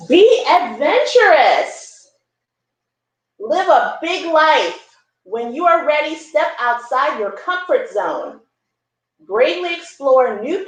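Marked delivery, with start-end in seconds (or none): 0.1-5.0 slow, bright storybook reading; reading to children. A narrator's reading. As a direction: read it to the little ones like a gift. Reading book text.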